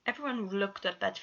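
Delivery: plain reading voice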